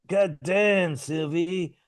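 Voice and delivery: a hint of a put-on [name] twang; lightly mock the Oscar clip; Chalamet's [name]. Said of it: "he was like".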